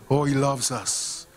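usual speaking voice

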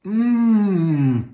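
loud, pleased hum of taste; savoring mmm. Real, not acted. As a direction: hum with delight at the food. Tasting food on camera.

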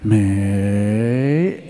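low, long "Mei" swooping up at the end; Chinese intonation. Shown as a demonstration. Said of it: "Yeah, one more time".

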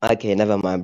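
ordinary talk

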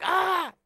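put-on girl's war cry. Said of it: "So she's like".

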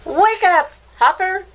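high, loud call to wake her son; a mother calling her sleepy son awake; mama waking her child. A narrator's reading. Mama calling Hopper to wake up, high and loud. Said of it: "said his mama".

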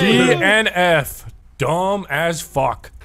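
slow, deadpan mock; slow mocking drawl. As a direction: drag each word out mockingly. Mocking a bad score.

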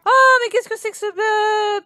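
high, loud amazed exclaim; surprised. Real, not acted. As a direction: blurt it in disbelief. Surprised the dog sleeps. (étonné, aigu et fort)

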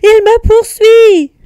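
very high, drawn-out loud cry; slow, high squeal of alarm; panicked, panting. A narrator's reading. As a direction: wail it like a hunted piglet. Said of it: "dit Nif Nif en haletant".

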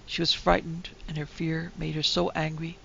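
ordinary delivery

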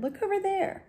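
put-on high, eager girl pointing; little girl voice. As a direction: pitch it up like a small girl spotting something. Violet spots the haystack.

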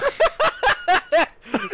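loud high laugh. Real, not acted. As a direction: burst of laughter. Laughing at the Bible joke.

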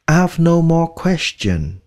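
slow model sentence. Shown as a demonstration. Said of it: "slow model sentence".

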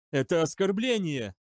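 high, indignant outburst; outraged. A narrator's reading. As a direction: spit out the insult. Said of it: "возмутилась Му". (возмущённо)

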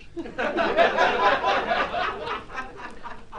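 silent laugh. Real, not acted.

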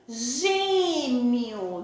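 "gêmeo" stretched slowly, ending in a U sound; final U sound. Shown as a demonstration. Teacher shows pupils how it is said, "gêmeo" ending in U. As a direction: draw out "gêmeo" as gê-miu. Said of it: "Eu digo gêmeo".

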